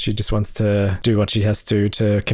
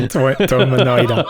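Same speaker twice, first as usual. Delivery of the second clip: laughing mock Aussie-Austrian accent; Arnie; 2 semitones higher, 3 dB louder than usual. Co-host teasing host's Arnie, broad Aussie accent. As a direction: echo a botched Austrian accent, Aussie-style. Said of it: "the Aussiest Arnie".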